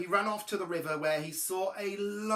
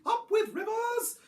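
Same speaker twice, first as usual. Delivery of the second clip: high-pitched, loud duckbill hoot; high honking chant; 5 dB louder than usual. A narrator's reading. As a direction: hoot it out like a cheering dinosaur. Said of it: "they hooted".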